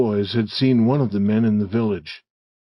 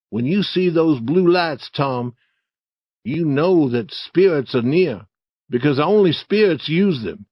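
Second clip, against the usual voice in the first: put-on higher, emphatic boy character voice; wary Huck; 6 semitones higher, 4 dB louder than usual. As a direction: argue earnestly about the dangers of spirits. Story figure Huck warns Tom.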